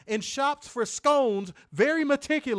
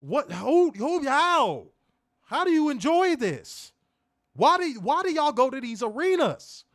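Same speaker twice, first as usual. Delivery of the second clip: higher, louder incredulous questions; angry rant; 5 semitones higher, 3 dB louder than usual. Real, not acted. Host questioning fans who attend, raised and exasperated. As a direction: fire disbelieving questions at fans. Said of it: "I have not had a rant in a minute".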